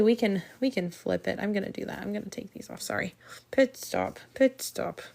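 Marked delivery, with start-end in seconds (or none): 3.5-4.2 talking around pins held in mouth. Real, not acted. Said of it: "I have a pin in my mouth". 4.3-5.0 words pushed past pins held in mouth. Real, not acted. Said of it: "I have a pin in my mouth".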